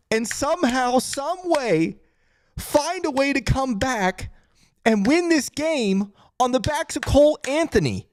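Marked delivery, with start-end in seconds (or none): none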